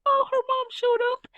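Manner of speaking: high, delighted squeal of surprise; excited gasp. Retold or imitated. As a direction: squeal like you just spotted her mom arriving. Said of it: "And I was like".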